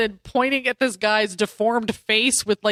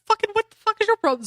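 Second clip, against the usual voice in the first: mock outraged newsboy; 10 semitones higher, faster than usual. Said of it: "He's like".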